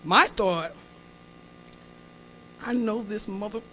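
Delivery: usual speaking voice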